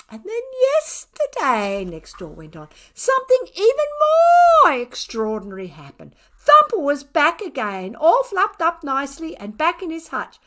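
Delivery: high sing-song neighbourly telling; gossipy neighbour. A narrator's reading. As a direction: tell it like juicy gossip over the fence. Neighbour continues story.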